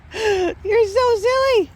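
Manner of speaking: slow high giggly pet talk; laughing at dog. Real, not acted. Laughing at dog's zoomies.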